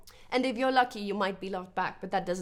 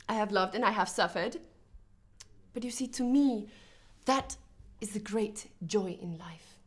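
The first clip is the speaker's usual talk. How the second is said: ordinary delivery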